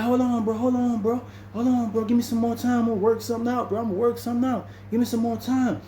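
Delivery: high, repeated begging voice; pleading for time. Retold or imitated. Sid begging for more time.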